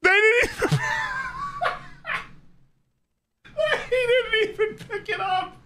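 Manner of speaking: helpless laughter. Real, not acted. Laughing at the captions failing on the Scottish accent, high and helpless. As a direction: crack up while saying it.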